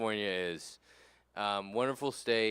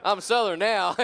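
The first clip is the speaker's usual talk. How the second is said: fake Southerner; 7 semitones higher, 12 dB louder than usual. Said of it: "and he's like".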